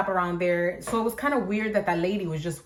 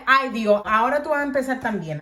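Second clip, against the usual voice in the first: exasperated; 5 semitones higher, 5 dB louder than usual. Retold or imitated. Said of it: "And I'm like".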